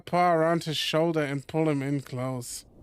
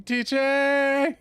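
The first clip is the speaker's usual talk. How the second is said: higher, louder call of the name; yelling a name; 8 semitones higher, 3 dB louder than usual. Pretend. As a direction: shout his name across the cliff. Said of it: "I'm yelling".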